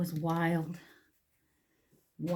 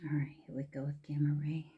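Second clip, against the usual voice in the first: whispering; 2 semitones lower, 8 dB quieter than usual. Real, not acted. Whispered admiring makeup.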